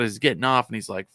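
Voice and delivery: usual speaking voice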